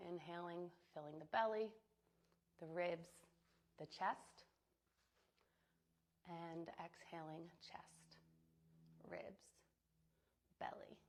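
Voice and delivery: calm, even meditation voice with long pauses; soothing visualization, phrase by phrase; guided meditation. Acted. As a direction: guide them gently into the imagery, unhurried. Leads breath and visualization.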